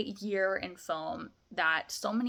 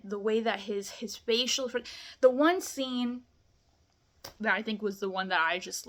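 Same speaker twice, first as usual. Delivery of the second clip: ordinary talk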